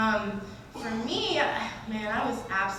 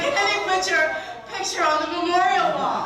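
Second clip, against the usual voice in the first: loud, cheerful, higher roommate voice; happy roommate; 8 semitones higher, 10 dB louder than usual. Retold or imitated. Roommate cheerfully reading the death scene, loud and high. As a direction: deliver bad news with total enthusiasm. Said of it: "She's like".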